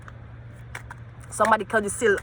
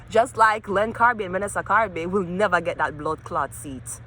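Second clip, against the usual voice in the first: ordinary talk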